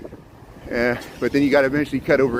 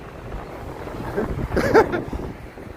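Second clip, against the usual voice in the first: breathy high laugh; 14 semitones higher, 5 dB quieter than usual. Real, not acted. Nervous laugh after fans.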